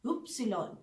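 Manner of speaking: upsilon, oop-see-lon; German Y. Shown as a demonstration. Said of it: "वाई". (upsilon बोलिए)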